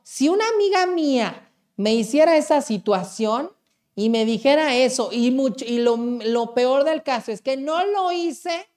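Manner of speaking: real indignant rant at full volume; worked-up rant. Real, not acted. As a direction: get carried away and blast it out. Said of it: "qué atacado me salí".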